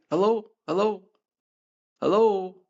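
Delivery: mock calling hello into a phone. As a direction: call out to a silent line.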